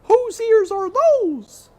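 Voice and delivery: high, loud, slow demand; cook demanding. A narrator's reading. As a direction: call out at the hidden ears. Cook questions mouse.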